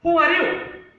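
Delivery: very high, loud call thrown back; the mountain echoing a boy's shout; echoed scream. Acted. Mountain answers the little boy, screaming back. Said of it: "There was a response from somewhere in the mountain".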